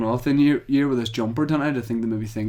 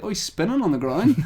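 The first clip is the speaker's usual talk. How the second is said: surprised; 8 semitones higher than usual. Retold or imitated. Retold shocked reaction.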